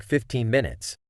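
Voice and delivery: usual speaking voice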